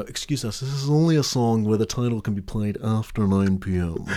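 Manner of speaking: quiet, put-on British voice; an apologetic Brit, badly faked British accent. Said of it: "that's my British accent".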